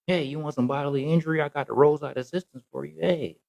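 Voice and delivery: mock chirpy insurance agent greeting a caller; customer service voice. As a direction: put on the eager call-center rep. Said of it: "that customer service voice".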